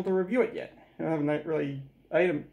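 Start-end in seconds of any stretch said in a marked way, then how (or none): none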